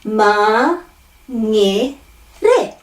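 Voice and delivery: Ma, nge, re as three separate syllables; syllable-by-syllable drill; broken at vowels. Shown as a demonstration. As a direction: tap the r and pause between Ma, nge, re. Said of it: "breaking up at every vowel".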